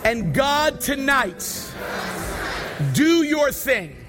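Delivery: high, slow, shouted prayer climbing to hallelujahs; fervent preaching prayer. Acted. Pastor leading the congregation in prayer, fervent shouted preaching. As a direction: pray it out with rising fire, hammering each phrase. Leads closing prayer at full force.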